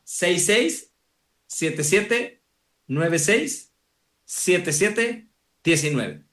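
digits in slow pairs with clear pauses; slow dictation. Real, not acted. Guest gives his WhatsApp number to listeners, slowly. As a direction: spell it out slowly, pair by pair. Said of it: "lo voy a decir despacito".

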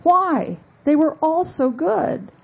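lightly voiced Queen, pitched well up; a hint of the Queen, high and lilting; the Queen replying. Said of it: "The Queen replied".

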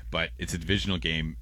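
ordinary talk, nothing marked

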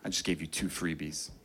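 very low, quiet, menacing warning; angry defender warning the kid not to shoot; menacing threat. Retold or imitated. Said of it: "He says".

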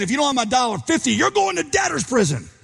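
high, hammed-up threat; mock menace, pitched well up; a ridiculous high-voiced threat of debtor's prison; threatening creditor. Hauling buddy off by throat.

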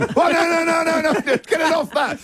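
high-pitched, frantic comic cries; mock panic. Host acts out a rapid ending at the monitor, in mock panic. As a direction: play up a flustered panic over the machine.

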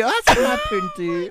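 mum's pet name. Retold or imitated. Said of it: "she's whiteified it".

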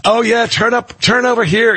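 casual mock directions from a friend; friendly navigation voice. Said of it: "She goes".

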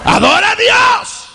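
preaching at full force. Acted. Pastor commanding the congregation to worship, preaching shout. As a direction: thunder it to the pews.

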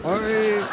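high hiccuping yelp; exaggerated hiccup noise; imitated hiccup. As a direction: mimic the installer's huge hiccup. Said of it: "y escuchábamos".

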